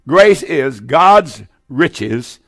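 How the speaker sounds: very slow, each word weighed; slow, deliberate teaching delivery; slow, spelled-out definition. Shown as a demonstration. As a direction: dictate it slowly so they can note it. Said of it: "now listen".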